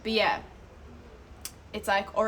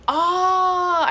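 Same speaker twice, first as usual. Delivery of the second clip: surprised oh; 9 semitones higher, 10 dB louder than usual. Real, not acted. Suddenly spots a chat message.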